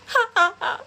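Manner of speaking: high, loud spelt-out "ha ha ha"; deliberate fake cackle at a lame line; sarcastic staccato laugh; mock laugh. Mocking laugh at his cheesy line.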